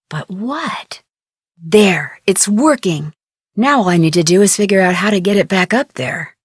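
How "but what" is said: hushed, voiceless wondering; whispered. Acted. Nancy puzzles over the cells to herself, whispered. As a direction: breathe the question to yourself.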